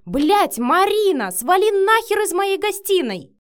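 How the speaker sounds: loud, high angry yelling; furious bark. A narrator's reading. Matvey throws Marina out of the room, roaring. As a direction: yell at her to get out. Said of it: "рявкнул Матвей".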